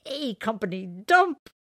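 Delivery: high, loud, slow gleeful cry; laughing. Acted. JC repeats Elephant's joke, laughing. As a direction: crow it out through laughter. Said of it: "he laughed".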